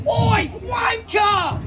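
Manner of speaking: high, drawn-out heckle; mock shouting. Lads heckling a passing cyclist, shouting. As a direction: jeer it across the street, long and high. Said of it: "they all started shouting at me".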